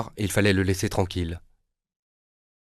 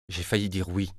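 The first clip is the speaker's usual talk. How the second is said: ordinary delivery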